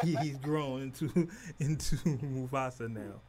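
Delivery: slow, laughing through words. Real, not acted. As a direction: laugh while saying it.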